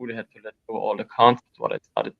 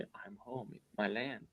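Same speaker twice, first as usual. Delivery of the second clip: much softer, almost under the breath; hushed inner voice; 23 dB quieter than usual. Retold or imitated. Telling a friend the words heard on the climb, hushed. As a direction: murmur it like a private inner voice.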